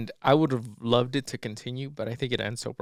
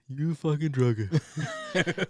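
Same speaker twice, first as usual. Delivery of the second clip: slow, higher put-on accusing voice; imitated catchphrase; 4 semitones higher, much slower than usual. Sasquatch catching Donnie handing out bumps, slow accusation. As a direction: draw out the mock accusation.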